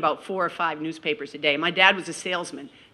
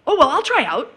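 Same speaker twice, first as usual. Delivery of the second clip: eager blurt; 9 semitones higher, 7 dB louder than usual. Retold or imitated. Teen self blurting eagerly.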